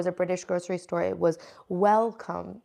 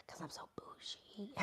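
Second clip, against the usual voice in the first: voiceless, very soft whisper; hushed joking aside; 25 dB quieter than usual. Pretend. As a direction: whisper it like a little confession. Whispered joke about her pinky habit.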